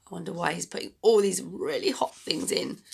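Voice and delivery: plain reading voice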